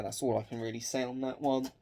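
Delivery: usual speaking voice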